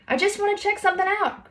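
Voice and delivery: high, carrying reply; a climber's high call back. A narrator's reading. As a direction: answer down from the rock. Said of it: "Haley called back".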